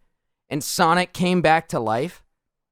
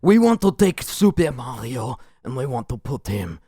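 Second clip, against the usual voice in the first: mock French director; 5 semitones lower than usual. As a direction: play a pompous French auteur.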